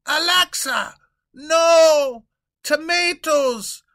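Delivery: loud, high panicked yell; horrified royal shout; king yelling. Acted. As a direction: shout to stop her. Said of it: "he continues yelling".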